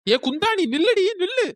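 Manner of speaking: high, loud call to stop her leaving; loud high shout calling someone back; shouting after her. Acted. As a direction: yell at her to stop, pitch climbing. Shouting after departing rival.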